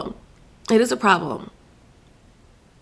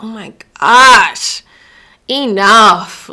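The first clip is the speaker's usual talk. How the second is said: exasperated; 3 semitones higher, 9 dB louder, much slower than usual. Real, not acted. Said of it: "it's just like".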